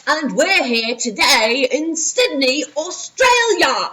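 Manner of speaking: loud, slow put-on Australian accent; big children's-show greeting in a mock Australian accent. Teacher imitating an Australian kids' band, loud hammed-up Aussie accent. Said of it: "think about what they sound like".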